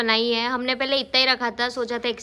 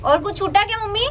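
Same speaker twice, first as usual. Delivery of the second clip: raised, higher call to mom; 9 semitones higher, 6 dB louder than usual. Real, not acted. Asking mom off camera if anything was missed, calling out. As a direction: call across the room to her mother.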